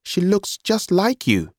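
put-on high, drawn-out young brother's voice. A narrator's reading. Brother's remark, high figure voice.

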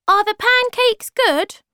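very high, loud little voice; tiny creature asking eagerly; squeaky Thingamy. Acted. Thingamy asks.

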